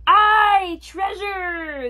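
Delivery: high, loud opening cry that eases off; crew shout. A narrator's reading. Pirate crew cheers the treasure, high shout.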